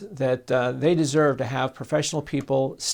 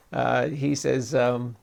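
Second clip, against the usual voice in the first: normal talking voice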